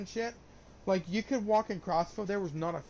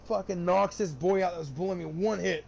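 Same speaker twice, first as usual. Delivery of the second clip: ordinary delivery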